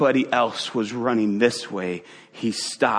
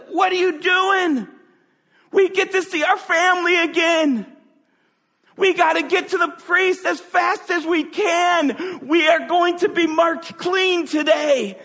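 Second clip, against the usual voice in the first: excited shout. Retold or imitated. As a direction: urge him on, breathless with joy. Lepers urging him to run.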